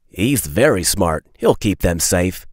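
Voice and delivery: plain reading voice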